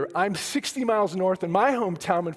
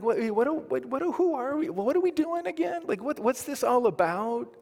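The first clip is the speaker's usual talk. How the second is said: mock confusion; 5 semitones higher than usual. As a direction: act lost, like you forgot why you came. Pastor voicing his own confusion.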